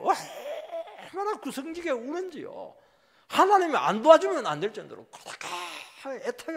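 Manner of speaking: slow, high, laughing mockery of their crying; mocking. Preacher mocking how Israel wails so sweetly.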